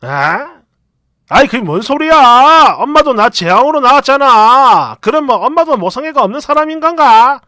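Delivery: loud, offhand and unimpressed; dismissive tone. Retold or imitated. Husband brushing off his mother, loud and offhand. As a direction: shrug it off as absurd, loudly. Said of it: "완전 어이없고 진짜 시큰둥한 말투로".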